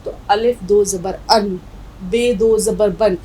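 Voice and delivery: "अन" and "बन" said slowly, each ending in a clear n sound; slow drilled syllables. Shown as a demonstration. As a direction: spell it out slowly like a teacher, landing on "अन" and "बन". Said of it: "लेकिन अगर दो ज़बर लगे हैं".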